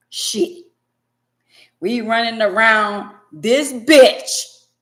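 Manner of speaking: loud, slow, drawn-out recital of the verse; dramatic reading. Acted. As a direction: belt the verse out slowly like a sermon.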